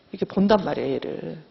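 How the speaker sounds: ordinary talk, nothing marked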